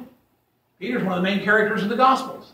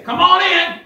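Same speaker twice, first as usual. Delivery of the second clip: high, loud friendly Southern call; welcoming Southerner; 11 semitones higher, 5 dB louder than usual. Retold or imitated. A Georgia homeowner welcoming the preacher, high and loud. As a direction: sing out a hearty invitation from the porch. Southern resident greets door-knocker.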